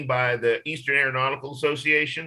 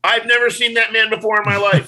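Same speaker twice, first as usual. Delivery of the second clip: mock denial; 9 semitones higher, 9 dB louder than usual. Joking denial of own photo.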